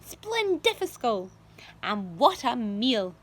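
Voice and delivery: loud, slow gloating exclaims; greedy delight. A narrator's reading. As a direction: relish each word greedily. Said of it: "Aunt Sponge cried out".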